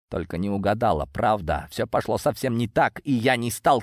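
ordinary delivery, nothing marked

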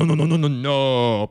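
ordinary delivery

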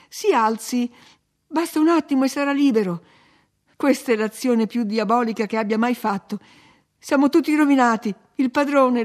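high, loud frantic protest; crying out loudly. A narrator's reading. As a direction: cry out as if all is ruined. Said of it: "gridavo forte".

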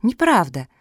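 high, loud indignant protest; defiant objection. A narrator's reading. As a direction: argue back with childlike conviction. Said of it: "решила поспорить Мафи".

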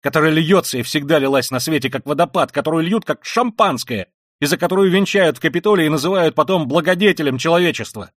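high, loud, impassioned ranting; frenzied tirade. Acted. Raskolnikov ranting at his sister, frenzied and loud. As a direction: hurl it all out in frenzy.

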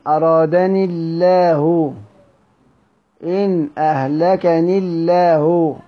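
slow recitation, أرادني الله as "aradani-llah" with thin L; light lam in الله. Shown as a demonstration. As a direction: stretch أهلكني الله, keeping "i" before a light lam. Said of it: "يرققهما حمزة".

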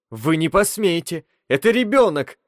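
outraged cry, rising high; furious exclamation. A narrator's reading. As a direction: cry out in fury. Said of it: "яростно воскликнула Близ".

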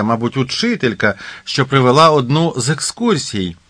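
ordinary delivery

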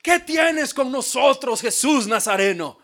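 high, loud demonic cry; the preacher voicing the demon's shout. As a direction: cry out like the possessed man. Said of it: "él decía".

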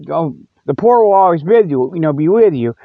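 ordinary talk, nothing marked

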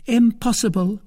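high-pitched exclamation; high, emphatic protest; crying out. A narrator's reading. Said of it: "cried Milady".

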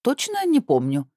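very high, airy brush-off; careless, sing-out vagueness; flippant. A narrator's reading. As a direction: shrug it off light-headedly. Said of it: "легкомысленным видом отмахнулась я".

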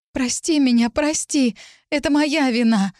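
high, frightened pleading; distraught outburst. A narrator's reading. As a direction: burst out begging forgiveness. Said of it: "вспыхнула я". (испуганная мольба высоким голосом)

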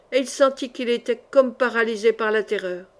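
ordinary delivery

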